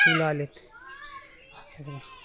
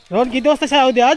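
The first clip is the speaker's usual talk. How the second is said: raised, higher call across the yard; 6 semitones higher, 9 dB louder, faster than usual. Real, not acted. Vlogger calls out to Rohan about school, raised voice.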